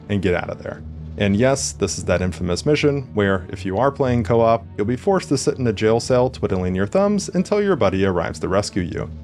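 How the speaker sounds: usual speaking voice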